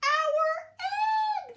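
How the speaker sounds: very high, loud joyful shout. A narrator's reading. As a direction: shout it in astonished delight. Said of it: "they shouted".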